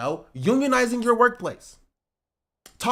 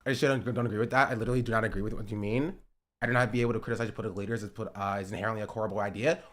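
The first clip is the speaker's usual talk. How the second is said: much lower and fast, a droning mock of the comment; mock chat reader; 13 semitones lower, 10 dB quieter, much faster than usual. Streamer mocking a chat comment in a low fast drone. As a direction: read the viewer's message back in a dull low drone.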